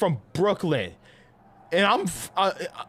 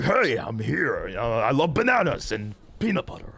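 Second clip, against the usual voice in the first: Winston voice; 4 semitones lower than usual. Retold or imitated. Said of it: "Winston's like".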